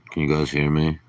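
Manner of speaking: ordinary talk, nothing marked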